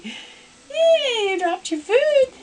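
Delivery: high, bright puppy cheer; excited praise. Real, not acted.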